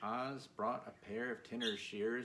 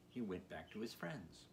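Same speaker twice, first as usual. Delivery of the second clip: usual speaking voice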